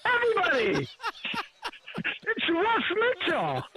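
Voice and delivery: excited announcement. Retold or imitated. Retelling Marcus Allen announcing him to everyone, laughing. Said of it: "And he's like".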